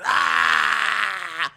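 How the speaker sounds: loud, high acted roar; wrestler's roar. Veer bursting through the door, loud acted roar. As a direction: burst in with a long yell. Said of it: "it's Veer and he's like".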